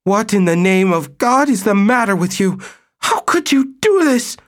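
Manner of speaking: wife shrieks at cheating husband; shrill outrage. Acted. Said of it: "in a shrill voice".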